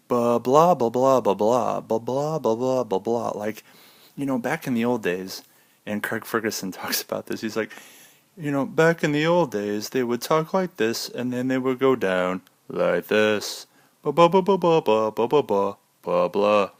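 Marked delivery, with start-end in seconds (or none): 0.1-3.3 mock the hyped YouTuber delivery; YouTube tone. Shown as a demonstration. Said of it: "there's a YouTube tone". 8.4-16.7 louder, higher mock newsreader, pitch sliding down; old news tone. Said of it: "That was the old news tone".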